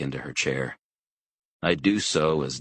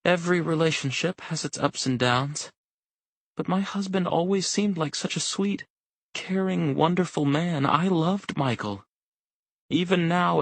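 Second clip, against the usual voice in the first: high wife voice holding back tears; a wife near tears, voice high and fragile; choking back tears; 12 semitones higher than usual. A narrator's reading. Wife telling McGrath about her husband, near tears. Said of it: "She begins to choke back tears".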